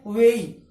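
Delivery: वेई gliding e to i, said loud; correct version. Shown as a demonstration. Teacher contrasts the spoken वे with the correct sound. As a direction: make वेई a clear diphthong. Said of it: "लेकिन होता क्या है?".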